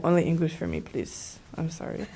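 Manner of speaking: meek apology. Retold or imitated. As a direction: voice shrinking to near nothing. Acting out her apology to Korean speakers.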